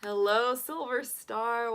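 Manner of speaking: usual speaking voice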